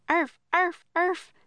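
high little dog bark; high-pitched imitated barks; Teddy barking. A narrator's reading. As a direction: yap like a small dog. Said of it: "Teddy barked".

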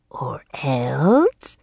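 whispery, drawn-out rising question; mocking Raven King. A narrator's reading. Raven King mocks the girl's threat, whispered. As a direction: echo the threat in a sinister whisper.

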